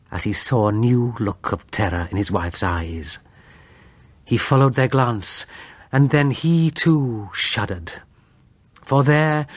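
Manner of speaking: usual speaking voice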